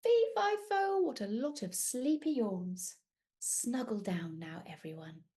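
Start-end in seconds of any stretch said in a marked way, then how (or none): 0.0-1.0 giant's shout. Acted. Giant chant. 3.4-5.2 soft bedtime voice. Acted. Bedtime hush.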